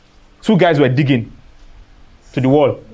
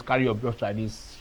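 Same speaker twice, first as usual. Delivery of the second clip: soft echo of the instruction, much quieter; quieter repeat; 3 semitones lower, 9 dB quieter than usual. Shown as a demonstration. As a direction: drop the voice for the repeat. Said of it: "I don't usually come and tell you".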